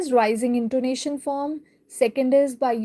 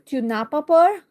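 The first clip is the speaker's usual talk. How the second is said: "Tu n'as pas peur?" with the voice lifting on "peur"; rising question intonation; 3 semitones higher, 4 dB louder than usual. Shown as a demonstration. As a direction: turn "Tu n'as pas peur?" into a question by raising the pitch at the end. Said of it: "we raise our voice level".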